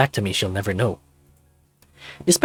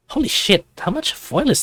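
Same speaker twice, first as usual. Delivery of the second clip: surprised; 11 semitones higher than usual. Acted. Narrator reacts to excessive foil.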